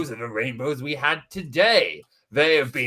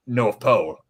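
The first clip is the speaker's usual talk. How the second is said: ordinary delivery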